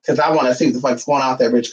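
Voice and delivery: normal talking voice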